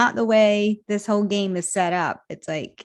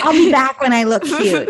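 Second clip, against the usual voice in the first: giggling through a joke line; laughing mock quote; 5 semitones higher, 7 dB louder than usual. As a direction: laugh while saying it.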